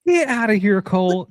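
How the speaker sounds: high, teasing get outta here; mock scolding a friend; playful dismissal. Real, not acted. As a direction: wave him off with a grin. Brushing off friend's joke.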